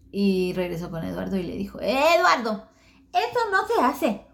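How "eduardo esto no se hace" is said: high, louder squeaky scolding; cartoon scolding. Little Japanese friend scolding Eduardo, high and loud, ending in laughter. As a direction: scold him like a tiny cartoon girl.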